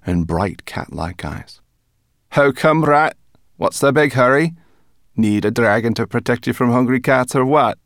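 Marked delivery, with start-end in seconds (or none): 2.3-7.8 loud, higher taunting brownie voice; cheeky brownie. A narrator's reading. Brownie teasing rat about her hurry, cheeky. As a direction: needle the rat in a raised creature voice.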